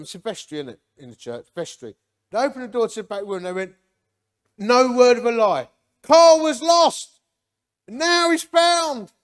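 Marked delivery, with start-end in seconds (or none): 4.6-9.1 high, loud, drawn-out mock announcement; booming proclamation. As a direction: proclaim it to the whole church, imitating the elders. Elders proclaim his conversion to church.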